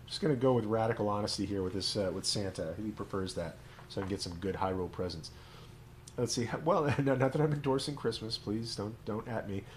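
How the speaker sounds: usual speaking voice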